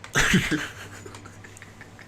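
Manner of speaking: breathy unvoiced laugh; stifled laugh. Real, not acted. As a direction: laugh through your nose. Laughing at the question.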